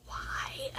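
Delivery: breathy whispered why through tears; tearful whisper. Real, not acted. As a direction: whisper it to yourself while crying. Tearful aside while crying on camera.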